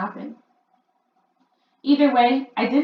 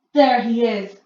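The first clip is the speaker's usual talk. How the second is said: louder, sharp snap; snapping; 4 semitones higher, 10 dB louder than usual. A narrator's reading. Grand High Witch snapping as she searches the platform. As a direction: bite the words off. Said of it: "snapped the Grand High Witch".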